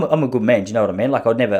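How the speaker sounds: usual speaking voice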